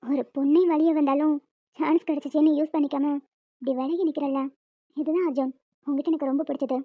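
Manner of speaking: low, soft and loving; tender admiration. Acted. Kaviya telling Arjun what she loves, soft and tender. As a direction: confide it softly, moved.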